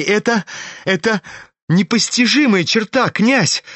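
high-pitched, astonished exclamation; sincere amazement. A narrator's reading. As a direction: stumble into astonishment, pitched well up. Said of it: "в искреннем изумлении". (изумлённо, высоким голосом)

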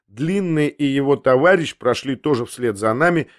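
ordinary delivery, nothing marked

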